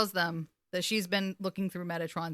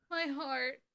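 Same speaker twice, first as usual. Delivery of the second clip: high, soft, touched murmur; hushed swoon; 6 semitones higher, 6 dB quieter than usual. Real, not acted. Fan overcome by the actor's delivery, softly touched. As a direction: let it fade, small and aching. Repeats it, more faintly.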